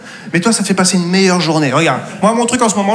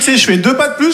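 ordinary talk

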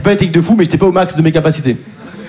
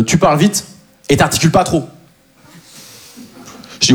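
usual speaking voice

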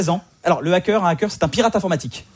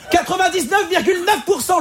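higher, mounting suspense countdown; suspenseful climax; 8 semitones higher than usual. Acted. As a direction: build to the last decimal, voice raised. Suspense climax of hacker story.

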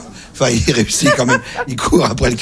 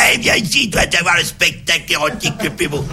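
high-pitched hawker calling out; eager barker pitch, far higher than normal; street tout; 9 semitones higher than usual. Acted. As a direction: lure a passer-by into the show. Tout hustles tourist into show.

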